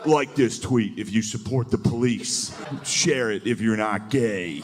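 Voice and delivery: slow, drawn-out mock post; hometown tweeter. Someone from back home urging shares, slow drawn-out demand. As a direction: read the demand out slowly, stretching it.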